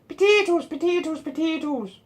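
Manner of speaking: high-pitched Irish chant, stepping downward; Irish caricature, high and falling away; mock Irish accent. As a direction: repeat it in a comic high Irish voice. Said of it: "I like those Irish girls".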